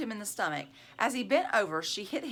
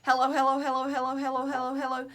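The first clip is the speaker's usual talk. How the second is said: rapid run of hellos, higher; quick teasing hellos, raised voice; as fast as she could; 5 semitones higher, 5 dB louder, faster than usual. Acted. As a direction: rattle them off fast. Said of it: "said Lori as fast as she could".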